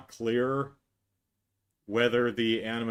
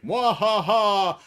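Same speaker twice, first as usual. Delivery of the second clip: slow, higher, louder mock evil laugh; villain laugh; 7 semitones higher, 7 dB louder, much slower than usual. Guard revealing himself, slow hammed-up evil laugh. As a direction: cackle like a cartoon villain. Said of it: "he's like".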